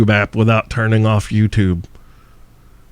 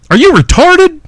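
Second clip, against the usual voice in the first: high, loud, disbelieving; incredulous yell; 4 dB louder than usual. Real, not acted. Host ranting at his listeners, loud and high.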